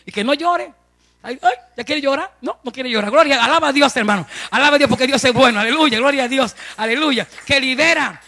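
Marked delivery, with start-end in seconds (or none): 3.1-8.2 loud fervent pulpit delivery; full-force preaching. Real, not acted. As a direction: preach it out to the congregation. Predicación a toda voz. (predicación a todo pulmón)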